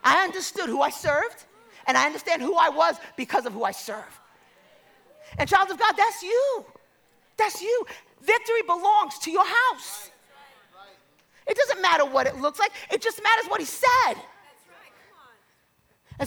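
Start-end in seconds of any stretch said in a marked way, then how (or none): 5.4-14.1 raised, higher preaching voice; rousing pulpit cry; preaching exhortation. Real, not acted. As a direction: proclaim it loud and high to the church.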